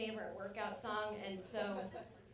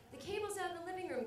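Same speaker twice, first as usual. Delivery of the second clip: put-on higher, quicker babysitter voice; lightly voiced babysitter calling the parents; babysitter's polite request; 7 semitones higher, faster than usual. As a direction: ask lightly and fast, pitched up. Voices babysitter asking on the phone.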